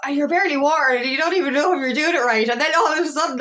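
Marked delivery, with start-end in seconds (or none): none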